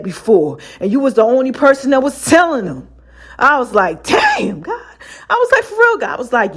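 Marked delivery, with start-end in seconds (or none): 4.0-4.8 much higher, amazed; stunned surprise. Retold or imitated. Reacting to what God told her. 5.6-6.1 much higher, amazed; stunned surprise. Retold or imitated. Reacting to what God told her.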